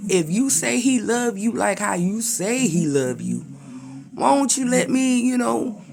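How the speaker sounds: high, fast, sly devil voice; the enemy bargaining. Retold or imitated. The enemy asking God to test Job, high and sly. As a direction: wheedle like a schemer asking permission. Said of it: "the enemy gonna like".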